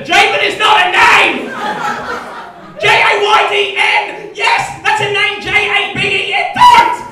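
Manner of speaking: shouted, high-pitched outrage; outraged rant. Acted. Comedian rants at young parents over a baby name, shouting in outrage. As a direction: yell it, spelling out letters at full force.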